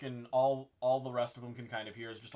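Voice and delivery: ordinary talk, nothing marked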